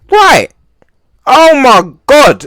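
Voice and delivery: sudden high, loud "Right. Oh my God", drawn out slowly; shocked outburst. Real, not acted. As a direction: gasp it out, as if you can't believe what you see. Stunned reaction to the clip.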